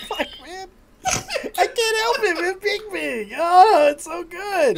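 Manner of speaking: high, laughing through the words; cracking up, can't stop; helpless laughter. Real, not acted. Cracking up at the name.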